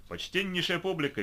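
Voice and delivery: loud, high showman announcement; booming circus ringmaster. A narrator's reading. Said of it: "вещал он".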